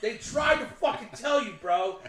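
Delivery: loud, high exasperated rant; slow down and punch the words in outrage; Dennis Leary. Real, not acted. Co-host scolding his pal over ignored movie warnings, ranting. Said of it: "All right, Dennis Leary, calm down".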